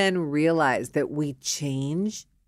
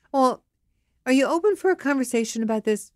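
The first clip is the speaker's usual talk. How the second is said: high, quick tentative question; pressing wife; 7 semitones higher, much faster than usual. Acted. Wife asking husband for a talk, pressing. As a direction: push gently for his attention.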